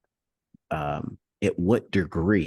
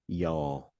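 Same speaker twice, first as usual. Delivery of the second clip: Texas y'all; 5 dB quieter than usual. Shown as a demonstration. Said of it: "give me your best y'all".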